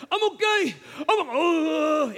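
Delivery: high, strained mock Georgia accent; Georgia accent, winded. Fallen pastor reassuring onlookers, winded Georgia accent. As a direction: groan it out, imitating a winded Georgia man. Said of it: "we hear in that Georgia accent".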